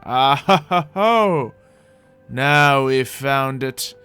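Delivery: ordinary talk, nothing marked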